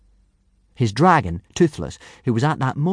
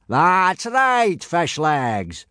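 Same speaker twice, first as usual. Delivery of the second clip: high, hearty boom; 9 semitones higher, much slower than usual. A narrator's reading. Gobber confirming a nervous pupil's answer, hearty boom. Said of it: "boomed Gobber".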